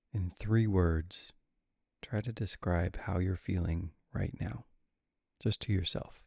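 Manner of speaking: calm, unhurried guide with long pauses; guided meditation. Real, not acted. Meditation guide settling listeners with adrenal insufficiency, calm and unhurried. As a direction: ease them gently into stillness, leaving room between phrases.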